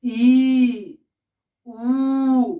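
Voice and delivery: loud, held-out ई and ऊ; long drawn vowels. Shown as a demonstration. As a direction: stretch ई then ऊ to double length. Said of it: "दीर्घ स्वर में हर्ष से दुगुना मात्रा का समय लगता है".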